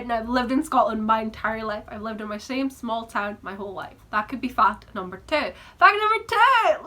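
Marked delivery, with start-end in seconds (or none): 5.8-6.8 high, loud, drawn-out exclamation; excited announcement. Real, not acted. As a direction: proclaim it triumphantly, voice leaping up. Sudden announcement of the segue.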